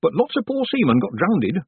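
high, loud village gossip; gossiping village woman. A narrator's reading. Woman telling Tiffany about the drowned sailors, high and loud. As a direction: pitch it up, spreading grim news.

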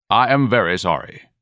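businesslike. A narrator's reading. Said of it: "in a businesslike tone".

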